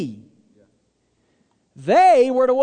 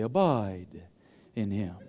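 lower, softer, somber radio preacher intoning "Abide in him"; D. James Kennedy; 9 dB quieter, slower than usual. Retold or imitated. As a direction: drop far lower and softer, solemn and weighty on "Abide". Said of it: "it sounds like something he ought to say".

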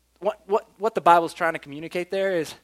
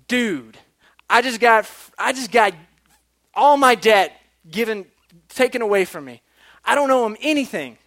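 loud, higher-pitched, elated brag; excited boasting; 6 semitones higher, 8 dB louder than usual. Acted. Servant boasts that his debt is gone, excited. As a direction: burst out with the news to a buddy. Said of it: "boasting in the fact that he is now".

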